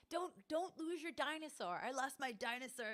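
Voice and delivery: earnest mock plea; quoting the movie guy. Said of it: "where the guy's like".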